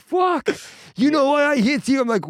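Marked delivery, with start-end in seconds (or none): 0.0-0.5 high, loud mock-panicked curse. Comic plays his younger self facing dad, mock panic. 1.0-2.0 high, loud mock-scolding dad; angry dad. Comic plays dad confronting his beaten son, mock anger. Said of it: "if he got angry".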